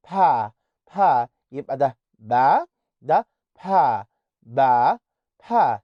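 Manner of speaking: with a puff of air; breathy P. Shown as a demonstration. Teacher demonstrating aspirated P. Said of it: "البا التاني ده بقى انت بتطلع فيه هوا".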